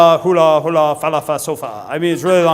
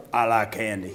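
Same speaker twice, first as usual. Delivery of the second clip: lower, softer simple confession; deadpan big kid; 7 semitones lower, 6 dB quieter than usual. Retold or imitated. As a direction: say it plainly like a big kid who loves sweets. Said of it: "Toe was like".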